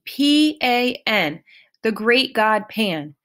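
"P A N" spelled letter by letter, slowly; spelling it out. Acted. Grover spelling the name for Percy, slow and insistent. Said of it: "he cried indignantly".

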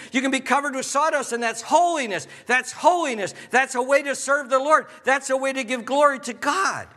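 high, ringing, rhythmic pulpit delivery; impassioned preaching. Real, not acted. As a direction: build the repeated refrain like a rousing preacher. Preaches at full force.

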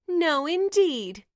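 high, loud, slow sneer. Acted. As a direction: drag out a cruel sneer. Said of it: "sneered the eldest stepsister".

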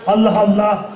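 normal talking voice

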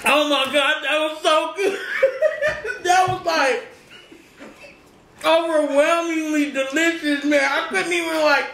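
laughing through the words. Real, not acted. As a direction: giggle while gushing about food. Laughing over delicious food.

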